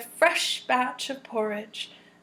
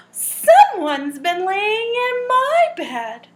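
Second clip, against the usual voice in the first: higher, louder, slow and shocked; Mama Bear voice; 7 semitones higher, 7 dB louder, slower than usual. Acted. Reading to children, mama bear finds her bed used, slow. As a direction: gasp then a startled mother bear.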